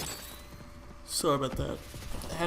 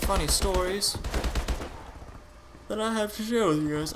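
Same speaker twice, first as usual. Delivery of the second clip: yawning through the words; stretched mid-yawn speech; 6 semitones higher than usual. Real, not acted. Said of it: "I don't know why I'm yawning".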